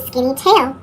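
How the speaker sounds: usual speaking voice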